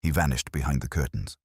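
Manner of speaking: ordinary delivery, nothing marked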